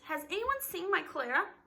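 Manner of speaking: high-pitched, worried mother's plea; frantic cry. A narrator's reading. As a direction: cry out for your missing child. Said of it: "Missus Brams cried frantically".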